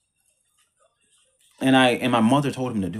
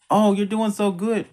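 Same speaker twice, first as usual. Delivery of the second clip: higher, sing-song mock mother praising the twin; mock sweet praise; 8 semitones higher than usual. Mother gushing over the twin's bad grades, mock sweet. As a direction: put on a fake cheery tone, lightly.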